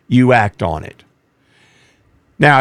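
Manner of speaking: normal talking voice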